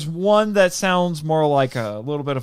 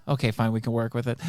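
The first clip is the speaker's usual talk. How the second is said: lightly voiced casting director; 6 semitones lower, 6 dB quieter than usual. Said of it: "And they're like".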